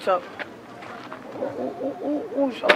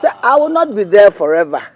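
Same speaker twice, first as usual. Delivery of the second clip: loud scolding; 8 dB louder, faster than usual. Acted. Mother scolds son loudly.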